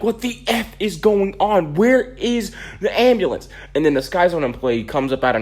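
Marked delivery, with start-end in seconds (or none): 0.0-3.5 high, slow, exasperated outburst; teacher cursing. Retold or imitated. Gym teacher fuming that the ambulance still hasn't come, high slow outburst. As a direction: blow up at the late ambulance. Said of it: "first time I ever heard my teacher curse".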